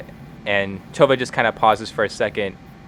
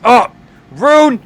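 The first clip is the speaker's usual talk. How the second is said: high, loud call of the name; exasperated shout; 14 semitones higher, 9 dB louder than usual. Acted. Ivar shouts at Rune after a crash in the house, exasperated. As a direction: holler it toward the house.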